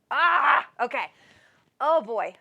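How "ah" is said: loud, high "ah!"; a sharp, high-pitched exclamation; sudden yelp. Real, not acted. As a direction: cry out in a short burst. Sudden outburst mid-reading.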